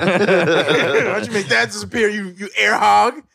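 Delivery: high, slow, laughing whine; laughing mock complaint.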